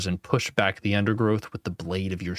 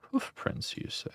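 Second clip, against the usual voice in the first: musing whisper; 10 dB quieter than usual. Acted. Playful musing at the table.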